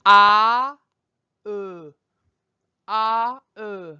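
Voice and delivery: loud open "Ah" then a short "uh"; French vowels ah, uh. Shown as a demonstration. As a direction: model "Ah, uh" with a wide jaw for the class to repeat. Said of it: "So one more time".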